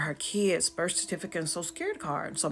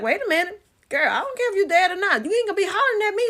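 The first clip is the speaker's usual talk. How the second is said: high, loud, indignant retort; hollering back; 13 semitones higher, 7 dB louder, faster than usual. Retold or imitated. Arguing back at her dead sister, hollering. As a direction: holler it back in a scolding rush. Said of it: "So now I'm hollering".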